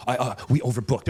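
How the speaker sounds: low, hesitant sheepish excuse. Retold or imitated. Airline worker admits overbooking to passenger, sheepish. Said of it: "he was so sheepish".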